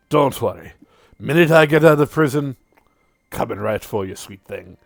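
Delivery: lightly voiced menacing cowboy; Casimir. Arrested cowboy promises Yuna he's coming, lightly menacing. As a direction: start firm, ease down into the threat. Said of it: "Yuna, and goes".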